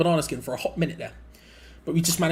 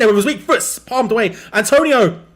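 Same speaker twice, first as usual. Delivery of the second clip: loud, high yell of the striker's name; excited shout; 7 semitones higher, 11 dB louder than usual. Real, not acted. Fan yelling at the screen during the attack, loud and excited. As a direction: shout his name as the shot goes in.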